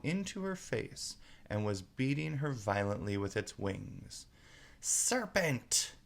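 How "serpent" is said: high shrieked accusation; pigeon scream. Acted. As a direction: scream it at the intruder. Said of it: "screamed the pigeon".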